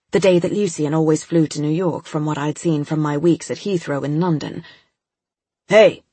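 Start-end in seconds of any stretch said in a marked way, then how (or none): 5.7-6.0 loud gruff call across the room; gruff shout. A narrator's reading. Agent calling out to the girl, gruff and loud. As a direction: bark it out like a suspicious officer. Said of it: "the agent said gruffly".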